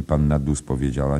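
ordinary delivery, nothing marked

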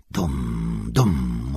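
low, deep booming dum; drum boom. A narrator's reading. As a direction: voice the drums deep and heavy. Drums imitated in reading. (niski, dudniący odgłos bębnów)

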